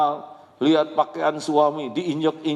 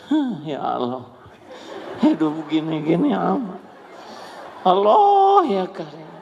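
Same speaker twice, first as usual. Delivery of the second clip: weary sighing complaint; 7 semitones higher, 4 dB louder than usual. Retold or imitated. Weary mother sighing in prayer.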